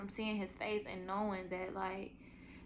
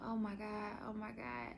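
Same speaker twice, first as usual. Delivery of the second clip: softer, breathless oh my God; quiet moaning; 7 dB quieter than usual. Retold or imitated. Softly groaning while retelling the pushing. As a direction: murmur it through the pain. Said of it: "and I'm like".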